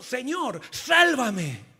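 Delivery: building to a loud cry on sálvame; cried-out plea. Acted. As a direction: cry out to be saved. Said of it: "Pedro gritó".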